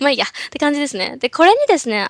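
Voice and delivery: ordinary talk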